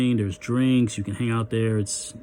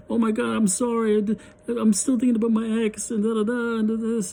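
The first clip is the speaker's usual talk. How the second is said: high, put-on crying voice; hammed-up weepy apology; mock crying; 11 semitones higher than usual. Imitating his date crying over her ex. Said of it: "she starts crying again".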